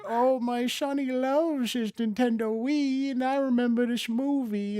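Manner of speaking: imitating a grandma gushing softly; doting grandma. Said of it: "grandma buys it for you ... because she's like".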